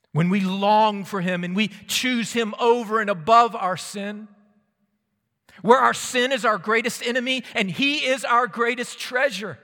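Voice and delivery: high, loud, driving sermon delivery; preaching at full force. Acted. Preacher urging people to choose God, full-force preaching. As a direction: raise the voice and proclaim it.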